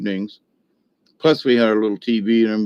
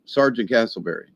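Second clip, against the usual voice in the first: ordinary talk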